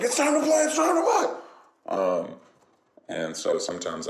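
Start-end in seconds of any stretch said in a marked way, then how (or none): 0.0-1.4 very high, squeaky excited dog voice; frantic high doggy excitement; hyped-up dog. Acted. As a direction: burst into a hyper dog begging to play. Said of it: "get really hyped out of nowhere".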